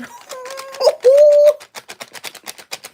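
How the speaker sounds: high, loud hooting laugh; high whoop. Real, not acted. Own sound while showing her leaf.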